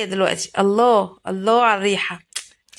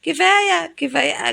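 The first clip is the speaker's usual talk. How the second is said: retold pleading protest; 10 semitones higher, slower than usual. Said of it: "حتى أنا قلت لها".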